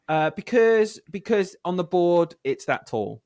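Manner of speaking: put-on a child answering proudly; imitating a child. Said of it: "they were like".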